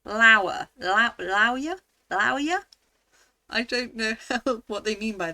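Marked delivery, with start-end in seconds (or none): 0.8-2.6 "lawyer" tried with a flower-like first syllable, rising as a question; puzzled pronunciation. Shown as a demonstration. Quiz taker trying the rhyme option on "lawyer", puzzled and questioning. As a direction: test out "lawyer" as LAU-yer, doubtfully. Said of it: "rhymes with flow".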